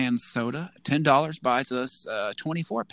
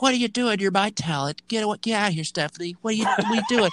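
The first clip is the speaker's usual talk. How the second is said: high, indignant boss voice; mocking Bischoff; 8 semitones higher, faster than usual. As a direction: scold the wrestler as a bossy manager, higher. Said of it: "Just be like".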